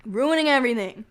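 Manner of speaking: loud, exasperated complaint; mad. Real, not acted. Streamer complaining about a troublesome viewer, mad. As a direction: lash out over the ruined work. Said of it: "I'm so mad right now".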